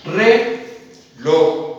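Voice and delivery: "Reloj" said as re-loj, two parts; split into syllables. Shown as a demonstration. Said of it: "lo vamos a hacer de esta manera". ("Reloj" separado en sílabas: re-loj)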